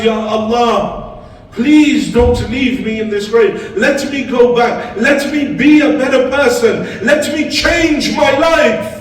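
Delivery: loud, high pleading cry, rising to the end; desperate calling out. Retold or imitated. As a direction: call out to God in desperation. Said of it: "in my desperation I called out".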